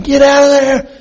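high, loud shouted order; desperate father shouting a warning; hollering. Retold or imitated. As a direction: yell it at the trapped boy. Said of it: "he's hollering at him".

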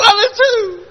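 very high, loud squealed reply; hammed-up crying squeal; mock-tearful wail. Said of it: "Just like that".

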